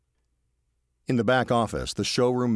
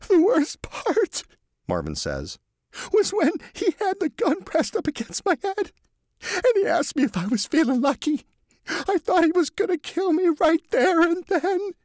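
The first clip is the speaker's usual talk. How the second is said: sobbing Marvin; 6 dB louder than usual. Acted. Marvin telling the police about the gun, high sobbing voice. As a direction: blubber it out through the tears. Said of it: "sobs into his handkerchief".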